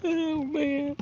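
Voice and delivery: whimpering "oh man"; half-crying over the lost fish; mock cry. Real, not acted. Mock crying to viewers over the lost crappie.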